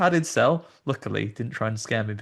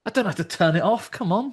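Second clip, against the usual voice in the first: higher, whining; mock protest; 8 semitones higher than usual. [name] protesting to the actor about his watch, higher. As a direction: plead lightly with the actor. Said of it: "I was like".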